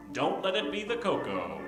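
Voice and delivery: ordinary talk, nothing marked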